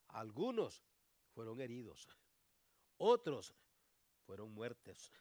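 a few loud preaching bursts. Acted. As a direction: punch the contrast from the pulpit. Preacher punching key words.

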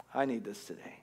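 whispered. Shown as a demonstration. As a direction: whisper it, close and private. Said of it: "at least, I need this today".

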